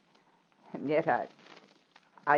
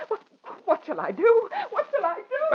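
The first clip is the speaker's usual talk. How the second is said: high, frantic pleading, much higher; panic; 6 dB louder than usual. Acted. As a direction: cry out in helpless panic. Widow panics after masked intruder.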